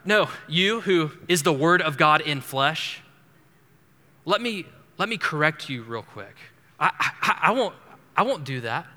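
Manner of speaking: cocky, condescending correction; preacher mocking Peter correcting Jesus, hammed-up arrogance; mock arrogant Peter. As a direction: talk down like you know better. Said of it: "He says, uh".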